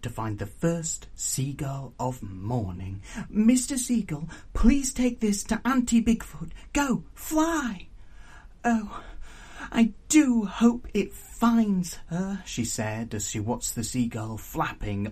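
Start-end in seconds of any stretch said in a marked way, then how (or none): 3.3-12.4 higher, earnest heroine voice; hopeful young Pignut. Acted. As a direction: plead with a seagull in a high character voice.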